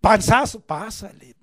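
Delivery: a hint of a teacher reading out a grade; mock teacher grading. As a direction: call out the barely-passing grade. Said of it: "A ver tu boleta".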